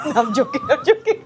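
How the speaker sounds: giggled, rising into high pitch; joking, words shaken by a laugh; laughing retraction. Real, not acted. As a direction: take it back through laughter. Laughingly takes back her gossip claim.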